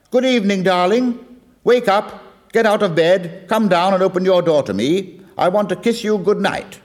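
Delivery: high, loud call up to her window; hammed-up yell of a tipsy farmer wooing. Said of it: "he lets out a yell".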